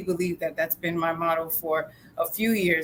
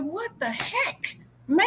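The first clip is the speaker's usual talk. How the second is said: exasperated; 11 semitones higher, slower than usual. Acted. Trapped man as the therapist walks off, exasperated. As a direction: draw it out in squeaky disbelief. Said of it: "He's like".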